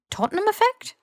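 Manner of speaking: high puzzled question; puzzled Ron. A narrator's reading. Ron mishearing Hermione, high puzzled question. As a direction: mishear it, confused.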